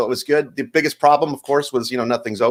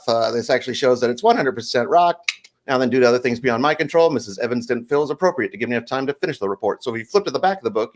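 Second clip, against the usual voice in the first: usual speaking voice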